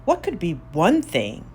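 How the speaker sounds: ordinary delivery, nothing marked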